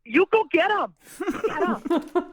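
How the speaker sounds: a lightly voiced Wisconsin grandma urging her on; a touch of a doting grandma. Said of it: "my grandma's voice".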